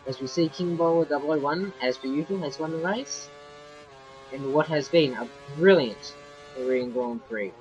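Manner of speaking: ordinary talk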